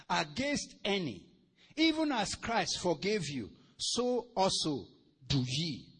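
loud, deliberate preacher's Bible reading; emphatic pulpit reading with drawn-out key words; scripture reading. Real, not acted. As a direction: declaim each phrase slowly, leaving space between them. Reads scripture aloud to congregation.

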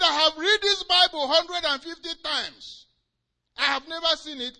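high, loud insistent cry; indignant protest. Retold or imitated. Hagin insisting to Jesus, loud indignant cry. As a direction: insist you never saw it. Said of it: "And the man said".

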